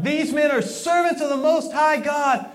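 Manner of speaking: loud, high mock cry of the slave girl. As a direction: call it out after them, high and loud. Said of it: "crying out".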